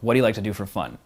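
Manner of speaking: firm statement tune, falling at the end of "fun"; commanding down talk. Shown as a demonstration. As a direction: ask it like an order, dropping on "fun". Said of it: "if you say it in a commanding way".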